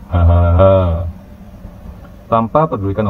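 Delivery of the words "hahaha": acted ha-ha-ha laugh; teasing laugh. A narrator's reading. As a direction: laugh mockingly at a friend. Friends laugh after the teasing. (tawa meledek)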